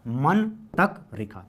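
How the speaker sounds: slow, drawn-out मन तक रेखा; slow mnemonic. Shown as a demonstration. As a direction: stretch मन तक रेखा out slowly. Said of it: "क्या?".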